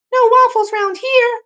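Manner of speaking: very high, loud falsetto kiddie voice; squeaky storybook reply; tiny Pancake. Acted. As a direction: pipe up like a little child.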